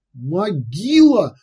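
happy squeal. A narrator's reading. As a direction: squeal it out in delight. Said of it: "счастливо взвизгнул Уйбуй".

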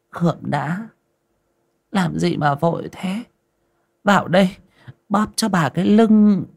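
high, coaxing seductive voice; sweet-talking.

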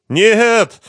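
high, loud cry; screaming. A narrator's reading. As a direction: cry out in desperate denial. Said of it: "закричал Лихоносов".

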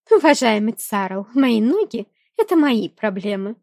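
loud, venomous retort. A narrator's reading. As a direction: spit the words back with poison. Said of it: "плеснула ядом в хозяина дворца".

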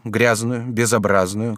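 ordinary delivery

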